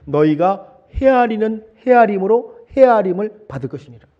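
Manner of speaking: slow, loud, measured proclamation; slow, weighty verse reading; solemn scripture quote. Acted. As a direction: proclaim the words of Jesus slowly. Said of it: "예수님께서 하신 그 유명한 말씀".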